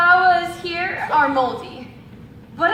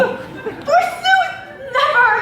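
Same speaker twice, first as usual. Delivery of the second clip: high laughing cry, the second louder; overplayed maiden outrage; hammed-up no, laughing through it; melodramatic refusal; 10 semitones higher, 3 dB louder than usual. Damsel dramatically refuses suitor.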